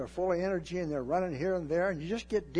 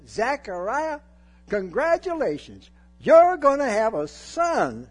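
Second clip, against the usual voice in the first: loud, high, slow proclamation; angel's announcement; 7 semitones higher, 10 dB louder than usual. Acted. Angel booming the news to old Zechariah, loud and high. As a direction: boom the news like a heavenly messenger. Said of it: "The angel said".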